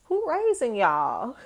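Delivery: who raising y'all, said slow in disbelief; incredulous. Retold or imitated. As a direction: ask it like you can't believe it. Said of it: "I'm like".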